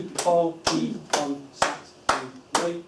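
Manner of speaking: slow, evenly beaten syllables of "polyunsaturated"; clapped syllables. Shown as a demonstration. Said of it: "if he can clap it".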